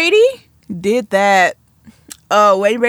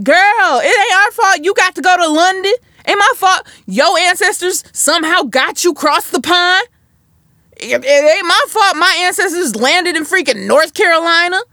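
put-on high, loud, sassy girl-talk; sassy clapback; 8 semitones higher, 4 dB louder than usual. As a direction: read her, high and punchy. Sassy retort aimed at Cynthia.

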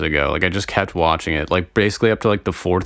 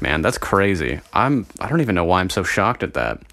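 ordinary delivery

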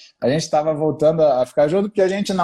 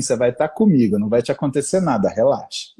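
lower, calm reassurance; reassuring; 6 semitones lower than usual. Retold or imitated. Husband calms his nervous wife, low and reassuring. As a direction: soothe her, nothing will happen.

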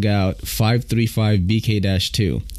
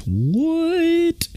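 much higher stunned what; surprised. Real, not acted. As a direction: react in disbelief. Surprised by material claim.

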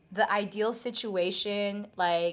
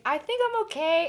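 retold unsure reply; 8 semitones higher, 3 dB louder than usual. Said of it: "And I was like".

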